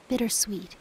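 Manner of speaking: usual speaking voice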